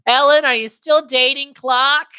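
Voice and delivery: put-on high, loud old-aunt question; batty aunt. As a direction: call it out high, out of the blue. Old aunt's random questions acted out.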